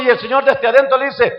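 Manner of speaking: ordinary talk, nothing marked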